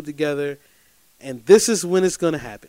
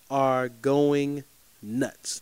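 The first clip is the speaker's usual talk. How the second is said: ordinary talk, nothing marked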